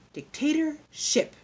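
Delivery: high, slow, drawn-out word; schoolteacher's deliberate repetition; teacher enunciating. A narrator's reading. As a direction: spell it out by sound for the class. Teacher repeats word for class.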